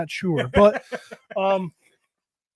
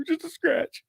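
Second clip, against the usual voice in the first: imitating daughter; 10 semitones higher, 5 dB quieter, slower than usual. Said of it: "I'm like".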